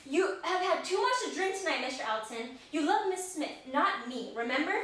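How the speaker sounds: put-on English accent. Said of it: "playing it in this accent".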